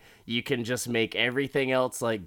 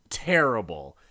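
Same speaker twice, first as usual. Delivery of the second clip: normal talking voice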